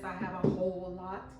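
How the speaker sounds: normal talking voice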